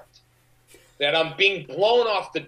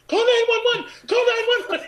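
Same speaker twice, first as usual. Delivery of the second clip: very high, louder mock screaming like a high school girl; hysterical wail; 4 dB louder than usual. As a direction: shriek it in a hammed-up panic. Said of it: "wailing into the phone like a, you know, like a high school girl".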